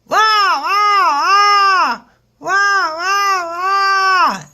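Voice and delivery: piercing, loud monkey screeches; full-throated imitation of a screaming monkey; monkey yelling. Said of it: "monkey that's not swinging is yelling".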